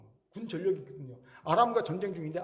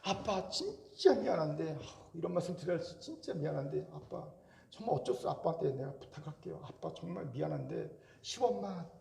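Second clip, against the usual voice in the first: put-on sheepish child asking; 4 semitones lower, 7 dB quieter than usual. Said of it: "정말 미안하게".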